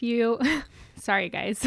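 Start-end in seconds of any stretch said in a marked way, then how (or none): 0.4-0.9 quick embarrassed laugh; little laugh at herself; short giggle. Real, not acted. Laughs at own yawn.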